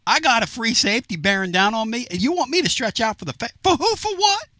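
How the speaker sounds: high, exasperated mock outburst; snapping back. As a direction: imitate an annoyed player snapping at reporters. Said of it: "He's like".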